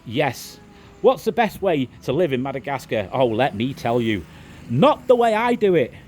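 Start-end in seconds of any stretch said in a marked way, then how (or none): none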